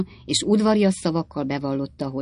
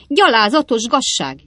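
put-on indignant old lady; 8 semitones higher, 7 dB louder than usual. A narrator's reading. Az öreg asszonyság.

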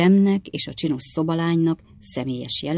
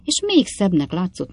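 ordinary delivery, nothing marked

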